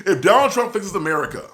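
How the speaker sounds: real laugh breaking into the words; giggly corrected line; laughing through it. Real, not acted. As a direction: say it while chuckling at the slip. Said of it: "there we go".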